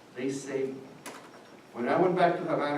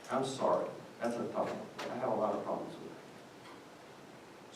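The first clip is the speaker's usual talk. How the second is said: quiet troubled aside; 3 semitones lower, 11 dB quieter than usual. Real, not acted. Troubled confession.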